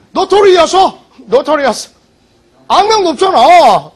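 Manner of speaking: loud, high, exasperated drilling; emphatic exclaiming. Real, not acted. Teacher excitedly drills a memory trick. (높고 큰 소리로 답답한 듯 강조하기)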